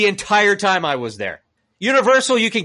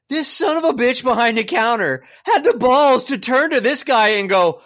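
ordinary talk, nothing marked

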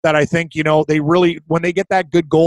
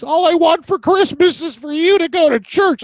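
high, pleading voice of a mom; mom's plea; 11 semitones higher than usual. Retold or imitated. Mom's text read to her son, in a high pleading voice. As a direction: sweetly beg like a mom. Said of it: "she sent me a text".

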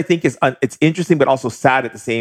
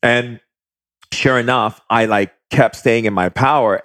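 normal talking voice

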